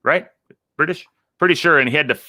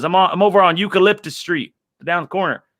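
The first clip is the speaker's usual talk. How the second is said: panicked caller; 6 semitones higher than usual. Retold or imitated. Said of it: "He goes".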